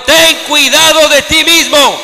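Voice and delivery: slow, loud scripture reading; lectura enfática. Acted. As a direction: read the verse out with weight. Reads Paul's words with force. (lectura bíblica lenta y fuerte)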